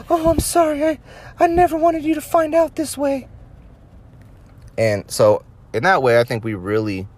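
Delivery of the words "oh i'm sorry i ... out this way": much higher mock apologetic falsetto; fake-sorry mistress in a squeaky voice; fake girlfriend voice. Girlfriend caller apologizing to the wife, high falsetto.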